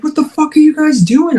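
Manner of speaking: high-pitched mock yell at the dogs; exasperated yell, held back in volume; retold yelling. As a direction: act out scolding the dogs, pitch way up. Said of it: "so I'm yelling at them".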